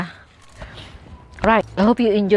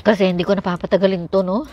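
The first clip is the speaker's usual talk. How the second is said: chewing while talking; eating fresh fruit; mouth full; faster than usual. Real, not acted. Seen 4: Bites strawberry at +1.2–1.9, chews while speaking afterward.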